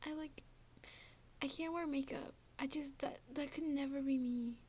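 high, soft mocking girly voice; mocking pick-me girl. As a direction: parody the not-like-other-girls girl. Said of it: "Like, shut up".

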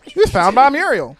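loud, high announcer voice; old radio announcer. Retold or imitated. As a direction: boom the intro line. Reciting show intro.

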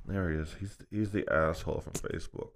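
low, hushed aside about someone; low, soft mock murmuring; imitated gossip. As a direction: point someone out under your breath. Imitating gossiping bar patrons.

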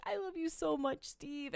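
high, soft mock-weepy voice; hammed-up tearful wife, hushed; imitated tearful confession. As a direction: plead love in a small crying voice. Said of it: "she's crying".

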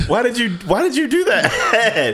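high laughing question. Real, not acted. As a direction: laugh through the words. Laughing at friend's remark.

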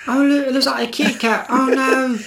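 high, sweet cooing voice; cooing beachgoer. Pretend. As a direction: coo at the little kitty. Mock beachgoer finding phone.

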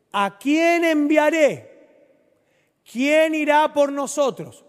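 a high, loud, slow, solemn call; voice of the Lord. Acted. Preacher voicing God calling for a messenger, high and slow. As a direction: let God's question ring out slowly. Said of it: "oí la voz del Señor que decía".

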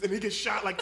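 ordinary delivery

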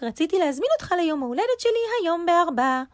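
fast, high-pitched rabbit voice; eager rabbit. A narrator's reading. Rabbit invites squirrel to his birthday, fast and high. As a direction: rattle it off high and quick.

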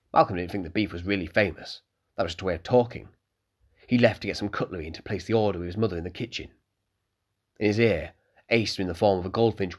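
plain reading voice

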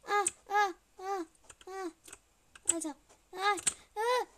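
high, loud short screams; panicked screams. Real, not acted. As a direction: yelp as the monster grabs you. Caught by the monster.

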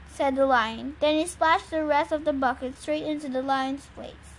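ordinary delivery, nothing marked

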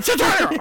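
high yelled cartoon hit sound; comic punch noise. Describing his fight noises, yelled cartoon hit. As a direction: pop it out like a comic-book sound effect. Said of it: "constantly making noises when I hit them".

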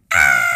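very loud burst of a scream; joyful scream. Retold or imitated. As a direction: scream out with sheer joy at the news. Reading "visa approval.

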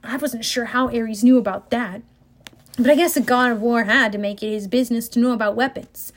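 plain reading voice

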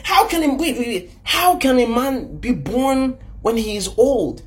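high-pitched incredulous questioning; baffled Nicodemus. Acted. Nicodemus questioning Jesus about being born again, high-pitched bafflement.

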